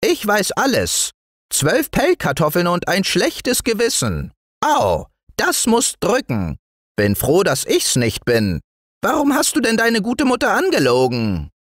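very high, needling gnome voice; tiny know-it-all woodland man; scolding little dwarf. Acted. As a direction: accuse the boy in a piping voice. Dwarf figure accusing the boy.

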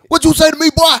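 shouted drill sergeant yell. Pretend. Mock drill sergeant after sergeant joke.

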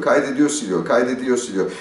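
usual speaking voice

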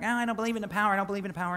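a hint of a whiny doubter, half-mocking; mocking the doubting woman. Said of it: "She's like".

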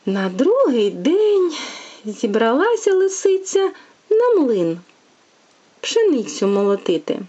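lively, sing-song bedtime tale reading; storytelling to a child. A narrator's reading. Mother reading a fairy tale to her little one, sing-song. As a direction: read it like a fairy tale for a toddler.

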